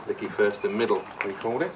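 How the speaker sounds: normal talking voice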